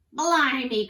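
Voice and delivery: loud, high outburst; pirate cry. A narrator's reading. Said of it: "cried Pete".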